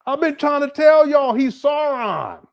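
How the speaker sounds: high, slow mock-exasperated yell; high joking shout, stretched out; excited shout. Real, not acted. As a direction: shout it like you've been saying it forever. Insisting loudly Umar is Sauron.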